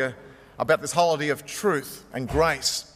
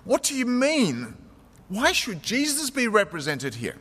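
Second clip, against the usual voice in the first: a touch of sullen bafflement, pitched up; baffled, sullen; 6 semitones higher than usual. A narrator's reading. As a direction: ask as if mildly baffled. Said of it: "somewhat baffled, somewhat sullen".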